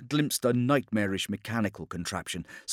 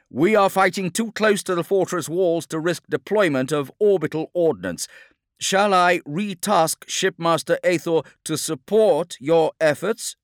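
loud, high, urgent military address; battlefield officer's call; 9 semitones higher, 7 dB louder than usual. Acted. Commander offering a captain support, battlefield officer's call. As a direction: project orders over the din of battle.

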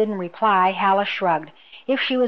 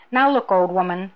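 ordinary delivery

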